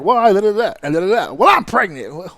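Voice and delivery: lightly voiced squabbler, babbling then playing the trump card; mock losing arguer. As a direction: mumble nonsense then drop the pregnant card smugly. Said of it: "Where it's like".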